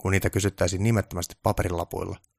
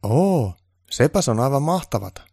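high, loud admiring elf voice; wowed, pitched-up exclamation; impressed awe; 7 semitones higher, 6 dB louder than usual. Acted. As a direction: gush with admiration for the boss. Onni is impressed by Baltasar.

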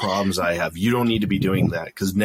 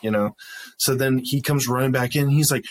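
ordinary delivery

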